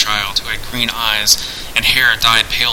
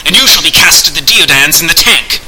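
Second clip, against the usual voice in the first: louder, higher scornful announcement; contemptuous; 5 semitones higher, 7 dB louder than usual. A narrator's reading. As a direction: lord it over him with contempt. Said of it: "announced Candide contemptuously".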